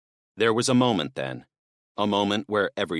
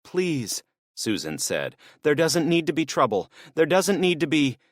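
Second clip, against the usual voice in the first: desperate pleading; 9 semitones higher than usual. Acted. Susan begging the men not to fight. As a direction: plead with them to stop.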